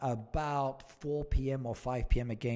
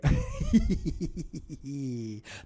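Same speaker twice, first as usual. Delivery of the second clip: high giggle trailing down; amused run of quick hee-hees; giggling laugh; 5 semitones higher, 4 dB louder than usual. Real, not acted. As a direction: giggle at a cheeky joke. Laughs at a viewer's joke.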